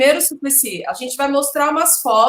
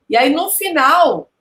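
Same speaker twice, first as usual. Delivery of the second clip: normal talking voice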